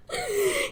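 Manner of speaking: a quick, high, breathy laugh; short high giggle. Real, not acted. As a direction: laugh in delight. Laugh after the outburst.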